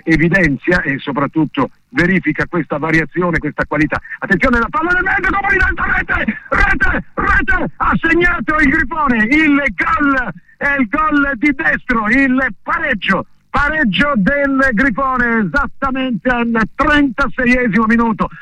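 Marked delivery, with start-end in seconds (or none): 4.2-5.3 high, fast, excited goal call; breathless italian goal commentary. Real, not acted. 5.3-7.7 high, fast, excited goal call; breathless italian goal commentary. Real, not acted. Repeated goal cry. 7.8-18.3 high, fast, excited goal call; breathless italian goal commentary. Real, not acted.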